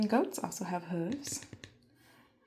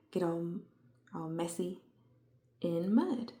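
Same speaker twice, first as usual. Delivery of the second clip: ordinary talk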